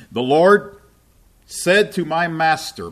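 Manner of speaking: loud, high and slow; proclaimed scripture. Acted. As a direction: ring out each word of the psalm. Preacher proclaims the psalm.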